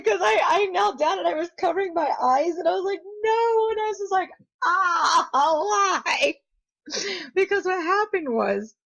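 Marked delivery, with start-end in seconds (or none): 3.0-6.3 high wail of no, why; anguished cry. Retold or imitated. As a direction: cry out in mock despair. Retold horror after the accident.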